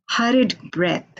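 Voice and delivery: ordinary talk, nothing marked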